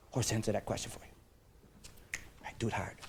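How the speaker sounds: soft aside under the breath; a hushed prompt; whisper. Shown as a demonstration. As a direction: whisper it to them.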